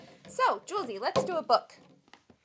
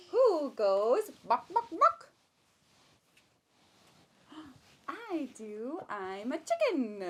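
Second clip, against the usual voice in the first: slow, higher sing-song question and answer; reading to a baby; 3 semitones higher, 5 dB louder, slower than usual. Acted. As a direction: draw the baby in with each animal. Reading picture book to baby.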